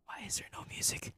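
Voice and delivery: soft, voiceless whisper. Real, not acted. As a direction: whisper the question under your breath. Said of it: "why am I whispering?".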